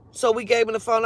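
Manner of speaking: normal talking voice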